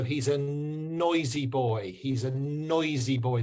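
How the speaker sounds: put-on slow "noisy boy", long n straight into "oi"; no w sound. Shown as a demonstration. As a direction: model "noisy boy" carefully without any w. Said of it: "There's no "w".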